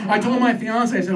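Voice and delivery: ordinary delivery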